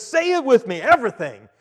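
loud, high ad-man prompt; pitchman call. Retold or imitated. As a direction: bark it out like a TV pitchman. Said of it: "And he says".

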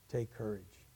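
"Take courage" dropped to a soft, hushed close; a quiet, intimate "Take courage"; soft quote. Shown as a demonstration. Repeated quote, softer take.

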